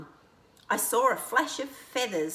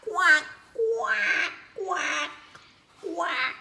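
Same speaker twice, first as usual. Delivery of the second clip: high loud duck quacks; quacking; 9 semitones higher, 3 dB louder, much slower than usual. Acted. Ducks interrupt the friends, loud quacking.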